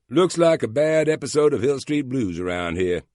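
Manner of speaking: lazy Southern cop voice, stretching the vowels; Southern drawl. A narrator's reading. Southern cop greeting a colleague in a drawl. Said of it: "in his languid Southern drawl".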